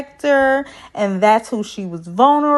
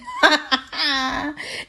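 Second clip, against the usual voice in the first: real laugh; 4 semitones higher, 4 dB quieter than usual. Real, not acted. Laughing at her own story.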